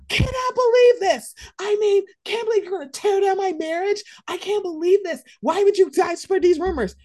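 high, loud, wounded disbelief; hammed-up victim protesting the rumor; mock outraged whining. Mocking a friend caught out, high hammed-up outrage. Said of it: "when someone brings up a rumor".

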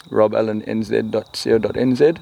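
normal talking voice

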